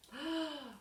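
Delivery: sharp storyteller gasp; dramatic gasp. Acted. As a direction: gasp in mock shock. Chair breaks in story.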